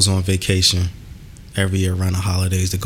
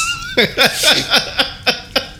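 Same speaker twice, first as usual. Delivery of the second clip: high laugh. Real, not acted. Laughing at sexy voice.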